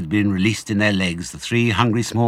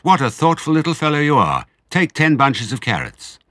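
proud praise; 7 semitones higher, 4 dB louder than usual. A narrator's reading. Fox praises his cub.

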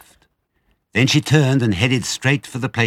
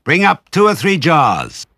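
slow, high, loud holler; stretched-out bossy call; calling back; 9 semitones higher, 8 dB louder than usual. A narrator's reading. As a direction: shout it down the cellar steps. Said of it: "the other voice called back".

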